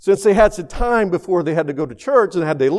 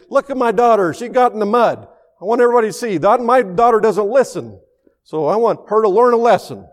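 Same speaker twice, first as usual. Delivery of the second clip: higher-pitched, indignant imitation of a father; mock public shaming; 6 semitones higher, 3 dB louder than usual. Father showing muddy daughter to the church, mock indignant proclaiming. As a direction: announce it to everybody, making an example of her.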